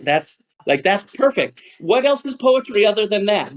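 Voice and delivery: high, thrilled praise; delighted. Retold or imitated. Teacher praising the student's poem, high and delighted. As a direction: gush over it. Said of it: "And I'm like".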